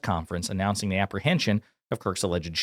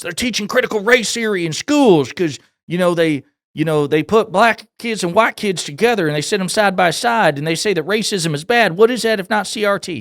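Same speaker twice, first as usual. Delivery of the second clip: mock Kentucky accent; 10 semitones higher, 7 dB louder than usual. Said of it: "He doesn't actually have that accent".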